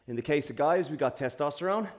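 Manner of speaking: soft low stretch guidance; calm cool-down. Real, not acted. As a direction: guide the stretch gently.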